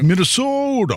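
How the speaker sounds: Minnesota said high, with long rounded Minnesota vowels; a playful Minnesota accent on Minnesota, pitched high. Shown as a demonstration. Co-host outdoes the host's Minnesota accent, playful. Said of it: "There you go".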